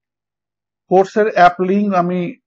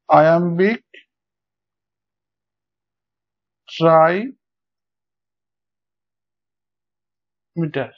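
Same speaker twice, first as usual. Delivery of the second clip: slow dictation; much slower than usual. Shown as a demonstration. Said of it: "তোমরা লিখবে এভাবে".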